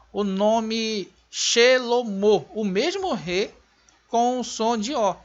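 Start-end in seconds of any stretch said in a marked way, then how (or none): 0.1-2.5 Shelomo dito devagar, terminando em o; Shelomo spoken. Shown as a demonstration. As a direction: pronounce Shelomo slowly, ending in o. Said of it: "E agora". (Shelomo pronunciado devagar)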